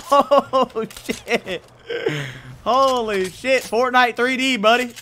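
high, loud, stretched excited shouts; hyped yelling. Real, not acted. Gamer hyping to chat after a wild play, loud excited yells. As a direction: celebrate like you can't believe it.

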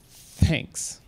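breathy whispered thanks through tears; whispered thanks, still hurt. Retold or imitated. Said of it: "she says".

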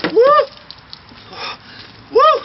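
shrieking woo from the cold; very high, loud whoop from the cold; in ice cold water. Real, not acted. Ice water dumped on him.

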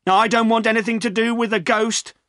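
high-pitched, panicky refusal; refuse, wanting no part of it; scared refusal. A narrator's reading. Poacher backing away from a supposed ghost, scared.

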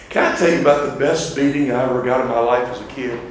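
usual speaking voice

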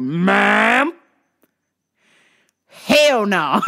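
drawn-out Ma'am, then loud refusal; sassy refusal. Retold or imitated. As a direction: shut the customer down with attitude. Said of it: "had to assert my blackness".

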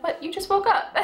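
high, puzzled disbelief; incredulous husband. Retold or imitated. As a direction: point out the obvious, amused. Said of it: "And he's like".

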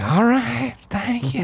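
high, forced-polite thank you; fake polite thanks. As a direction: squeeze out a chirpy, fake-cheerful thanks. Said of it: "And I was like".

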